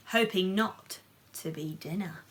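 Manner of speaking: plain reading voice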